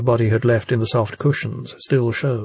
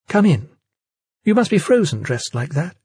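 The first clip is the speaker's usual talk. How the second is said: higher, lightly voiced hostess; character voice; 7 semitones higher than usual. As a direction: lift the pitch for the hostess. Jane greets Enzo at door.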